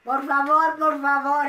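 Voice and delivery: loud, high-pitched plea; pleading. Real, not acted. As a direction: beg out loud to the audience. Joins in begging the audience.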